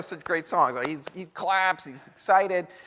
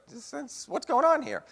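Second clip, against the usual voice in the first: baffled president; 13 semitones higher than usual. Retold or imitated. President fooled by ventriloquist whistle.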